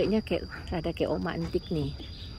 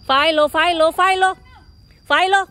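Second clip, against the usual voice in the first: loud, much higher repeated call; sharp calling out; 9 semitones higher, 10 dB louder than usual. Real, not acted. Owner sharply calls her misbehaving cat, loud and high. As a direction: snap the name out to stop the cat.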